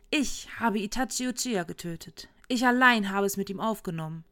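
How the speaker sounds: lightly voiced boastful drunk; a hint of a drunk showing off; drunken braggart. A narrator's reading. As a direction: boast loudly to the pub crowd. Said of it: "Sichtlich betrunken brüllte der Typ".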